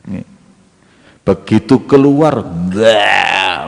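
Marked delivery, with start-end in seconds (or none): 2.4-3.7 drawn-out "bleh" of disgust; comic vomiting noise; mock retching. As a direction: imitate someone throwing up. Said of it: "muntah ini perempuan".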